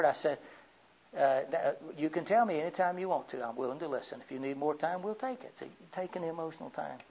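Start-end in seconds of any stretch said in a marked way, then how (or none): 2.0-5.6 very soft, gentle offer to listen; patient reassurance. Retold or imitated. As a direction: softly let her take her time. Reassuring the upset young lady.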